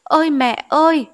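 high, loud grieving cry for mother; a daughter's loud, grieving call to her mother; anguished wail. Acted. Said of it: "tru lên những tiếng não nùng".

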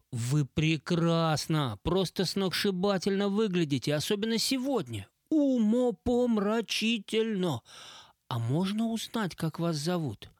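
high, slow, gushing compliments; breathy flatterer. Acted. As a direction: lay on the charm, slowly. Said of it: "придыхательным стилем общения".

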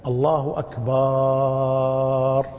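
أكبر ending in a long level chanted aaa before the r; lengthened ba. Shown as a demonstration. Teacher demonstrates another mistake, final vowel chanted long. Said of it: "غلط أيضا".

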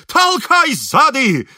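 loud, high, stretched-out command; terrible yell. A narrator's reading. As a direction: yell for a push from behind. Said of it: "закричал Шаринов страшным голосом". (страшный крик-команда)

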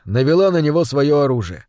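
usual speaking voice

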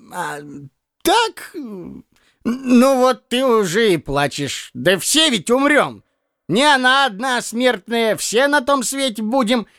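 put-on a fussy old clerk scolding a younger man; hint of a grumbling old man. A narrator's reading. Piskarev consoling clumsily.

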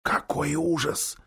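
whispered. A narrator's reading. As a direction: breathe it in horror. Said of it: "шепнул Дигори".

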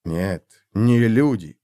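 ordinary delivery, nothing marked

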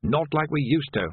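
plain reading voice

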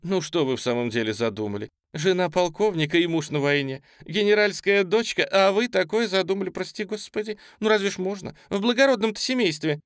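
высокая бойкая бабья скороговорная укоризна; громко стыдить, высоким голосом; голос служанки. A narrator's reading. Служанка Таня стыдит Осетрова. (причитать с возмущением, высоко и звонко)

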